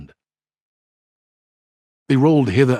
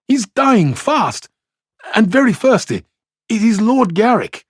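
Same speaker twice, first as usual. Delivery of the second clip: urgent; 12 semitones higher, 4 dB louder than usual. A narrator's reading. Man begging help for dying lord.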